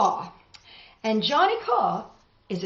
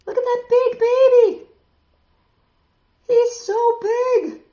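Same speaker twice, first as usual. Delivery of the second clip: much higher and louder, delighted wonder; amazed; 13 semitones higher, 9 dB louder than usual. Real, not acted. Teacher showing the class the picture, high excited wonder.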